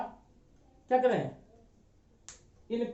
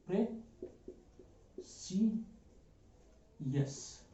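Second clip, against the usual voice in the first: Precious very slow and soft, pre ... si ... yas, long pauses between; hushed, drawn-apart pray, शि, यस; syllable by syllable; 2 semitones lower, 10 dB quieter, much slower than usual. Shown as a demonstration. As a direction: break precious into three hushed, widely spaced pieces. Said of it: "इसको काफी लोग precious पढ़ते हैं".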